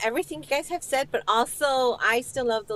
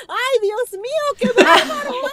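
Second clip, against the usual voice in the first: high, loud imitation of a shocked mother; hammed-up Spanish outcry, breaking into laughter; exclaiming mom; 12 semitones higher, 9 dB louder than usual. Guest imitating mom watching the betrayal, exclaiming mom. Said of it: "my mom, you know, was like".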